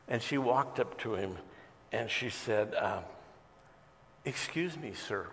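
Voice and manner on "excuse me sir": softly, gently addressing a stranger; barely above a murmur, polite; very quiet. Retold or imitated. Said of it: "very quiet".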